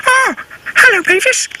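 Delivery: puppy greeting the pony, happy and high. Acted. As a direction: answer with a glad high yap of a voice. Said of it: "said Wee Reg".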